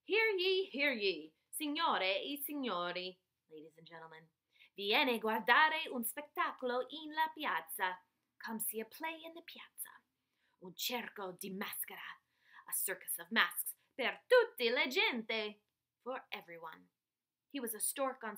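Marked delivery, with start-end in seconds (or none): 0.0-1.3 loud town-crier call to the crowd; Dad shouting. Acted. Father calling a crowd in the piazza, loud town-crier shout. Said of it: "Dad shouted". 1.5-16.9 a hint of a showman calling out; lightly played barker inviting passersby; street announcer. Father inviting the piazza crowd, mild barker voice. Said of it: "Dad shouted".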